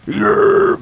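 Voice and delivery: high rough false chord yell; distorted scream on yeah. Shown as a demonstration. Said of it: "when you do the false chord".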